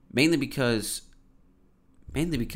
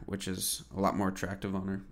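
ordinary delivery

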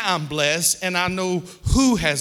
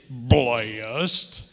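deep, stretched-out word, chin tucked in; churchman impersonation; 5 semitones lower than usual. Retold or imitated. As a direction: mimic the church brother's low greeting reply.

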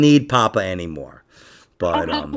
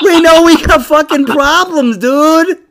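high, loud comic yell; comic outburst; 13 semitones higher, 7 dB louder, slower than usual. Pretend. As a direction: shout it in mock alarm. Mock-alarmed comic outburst.

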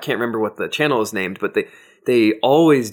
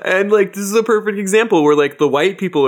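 excited; 9 semitones higher, 5 dB louder than usual. Real, not acted. Heated point.

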